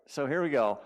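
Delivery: ordinary talk, nothing marked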